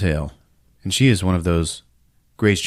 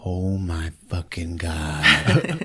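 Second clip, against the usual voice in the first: very slow, low stunned awe; awestruck; 4 semitones lower, 4 dB quieter, much slower than usual. Acted. Kid watching a ship fly away, slow low awe. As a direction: drag it out low, dumbstruck.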